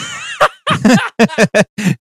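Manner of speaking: high burst of real laughter; laughing. Real, not acted. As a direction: crack up, high and long. Laughs at own predictability.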